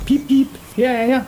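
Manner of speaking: high piping call from hiding; small boy piping up high; squeaky little boy. Acted. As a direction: chirp it out like a tiny bird.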